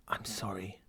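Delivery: soft, voiceless whisper. A narrator's reading. Woman apologizing as she lets go, whispered. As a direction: breathe the apology with no voice. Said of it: "she whispered".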